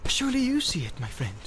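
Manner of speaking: coaxing man's voice; persuasive. A narrator's reading. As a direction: lean in and persuade. Boromir persuading Frodo.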